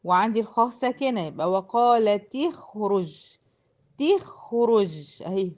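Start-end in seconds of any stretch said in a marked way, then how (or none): 1.5-5.2 slow "وقالت تخرج" as wa qalati-khruj; drawn-out tajweed example, "وقالت تخرج" linked by i; demonstrating the kasra link. Shown as a demonstration. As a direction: join "تخرج" as ti-khruj, ta into kha. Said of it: "كسرت الت ودخلت على الخاء الساكنة".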